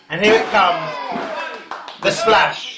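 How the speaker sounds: rising live ringside call; wrestling commentator. Acted. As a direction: build up to the big move.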